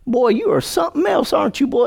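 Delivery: high, teasing flattery; butter him up in a high mock voice; mock flattering devil. Preacher voicing the devil praising you, high and teasing.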